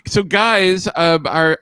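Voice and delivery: ordinary delivery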